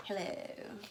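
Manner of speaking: a light snaky hiss; snake hiss. Acted. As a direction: hiss a soft hello. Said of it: "That's what I imagine that they're hissing".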